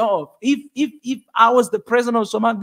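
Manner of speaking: normal talking voice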